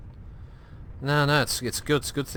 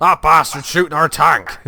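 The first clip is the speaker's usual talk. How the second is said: mock West Country shout; 4 semitones higher, 9 dB louder than usual. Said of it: "He's like".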